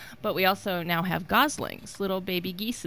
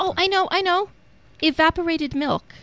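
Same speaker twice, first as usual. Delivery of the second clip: put-on higher, eager child voice; kid voice; 10 semitones higher than usual. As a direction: answer like an excited kid. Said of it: "I'm trying to do my kid voice".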